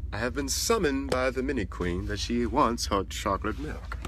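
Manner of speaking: slow, measured royal herald's announcement; mock-formal servant. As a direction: announce it like a ceremonious servant, slowly.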